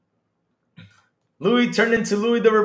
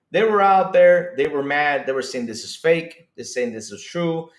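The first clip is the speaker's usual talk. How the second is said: ordinary talk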